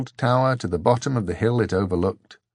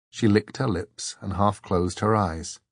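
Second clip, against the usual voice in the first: ordinary delivery, nothing marked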